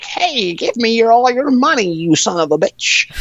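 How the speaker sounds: high, cackling witch demand. Retold or imitated. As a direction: screech it like a greedy witch. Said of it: "and she's like".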